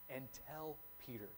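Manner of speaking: softly, as a personal message to Peter; hushed, tender "Peter"; tender aside. Shown as a demonstration. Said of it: "he specifically mentions".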